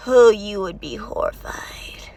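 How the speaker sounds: slow, hushed whisper; whispered warning to a naive character. Real, not acted. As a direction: breathe it as a dark secret. Ominous hint about his fate.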